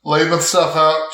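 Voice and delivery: usual speaking voice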